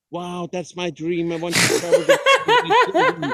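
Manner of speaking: mock-gushing, rising to a high, loud laughing squeal; gushing people. Mimicking people who dream of world travel, high laughing gush. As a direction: ham up the starry-eyed admirer. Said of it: "where they were like".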